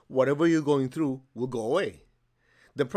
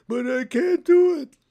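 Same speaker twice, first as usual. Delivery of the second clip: high, loud, slow whining student; whiny complaint; 11 dB louder, slower than usual. Retold or imitated. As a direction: whine like a helpless pupil.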